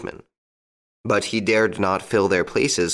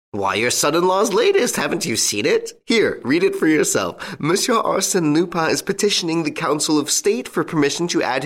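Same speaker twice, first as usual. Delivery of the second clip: higher-pitched, eager friend passing on news; lively gossiping friend; 7 semitones higher than usual. Acted. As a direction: play the friend relishing the latest news, pitched up.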